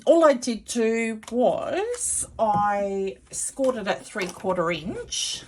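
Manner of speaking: ordinary talk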